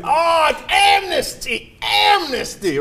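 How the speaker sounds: high, slow hammed-up protest; mock outrage. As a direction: cry it like a scandalised pundit. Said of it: "They would have".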